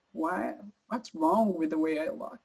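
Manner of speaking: normal talking voice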